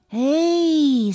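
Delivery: long, high drawn-out "hey" of dawning admiration; slow appreciation. A narrator's reading. Boy hears a scream from the ground, slowly appreciative. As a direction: stretch it out, impressed. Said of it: "said Dippy with slow appreciation".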